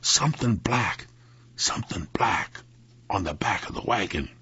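hushed, dropping into a whisper; dazed hush. A narrator's reading. Jeff able to say only this, whispering it. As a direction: repeat it numbly, fading away. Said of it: "All Jeff could say was".

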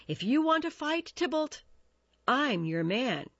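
high-pitched challenge, easing down; challenging cry. A narrator's reading. Mercutio offers to fight Tybalt, crying out. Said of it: "he cried".

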